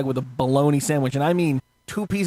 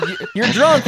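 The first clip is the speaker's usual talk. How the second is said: laughing; 8 semitones higher, 4 dB louder than usual. Retold or imitated. Cop's accusation.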